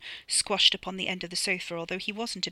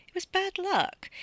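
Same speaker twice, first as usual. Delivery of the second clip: sweet consoling; 13 semitones higher, slower than usual. Acted. Bunty consoles Paul.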